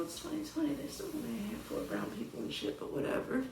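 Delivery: very soft, under the breath; muttered aside. Real, not acted. Reviewer grumbling about the casting, hushed aside. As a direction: drop to a near whisper.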